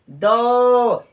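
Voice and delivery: high, loud, drawn-out call; loud called-out answer. Real, not acted. Teacher answering how many children, called out loudly. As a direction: call it out to the whole class.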